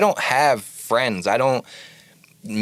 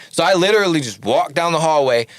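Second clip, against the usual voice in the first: normal talking voice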